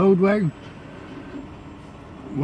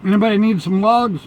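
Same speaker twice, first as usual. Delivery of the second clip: calling out; 6 semitones higher, 5 dB louder than usual. Real, not acted. Calling out to chat.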